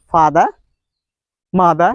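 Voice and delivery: "father, mother" with open vowel endings, no R; non-rhotic "father, mother"; dropped R. Shown as a demonstration. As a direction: drop the final R in "father" and "mother". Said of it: "They drop the sound".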